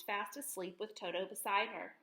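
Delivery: usual speaking voice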